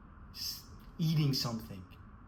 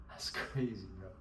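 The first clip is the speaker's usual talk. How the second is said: laughing; 4 semitones lower, 7 dB quieter than usual. Real, not acted. Laughing aside.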